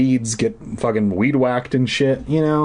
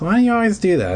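exasperated; 10 semitones higher, much faster than usual. Real, not acted. Reader ranting at the book's cliffhanger, exasperated. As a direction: complain at the book. Annoyed rant at the book.

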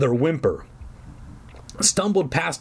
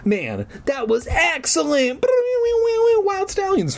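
hammed-up cheer. A narrator's reading. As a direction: cheer like an over-excited goofball. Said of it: "Fergie cheered".

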